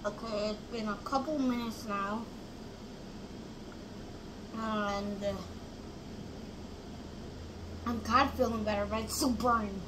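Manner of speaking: speaking into a glass; muffled by drinking glass; sipping milk while talking. Real, not acted. Seen 1: Glass held at lips, sipping milk throughout the labelled words.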